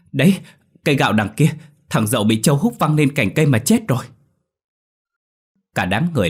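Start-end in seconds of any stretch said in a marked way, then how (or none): 0.1-4.0 higher, louder breathless pointing; out of breath. A narrator's reading. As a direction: gasp it out after running. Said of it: "vừa thở vừa nói".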